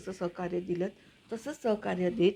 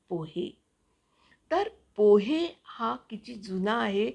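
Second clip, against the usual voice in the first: louder, bright voice-over read with lifted pitch; 4 dB louder than usual. Acted. Reads her own voice-over piece. (उत्साही voice over वाचन)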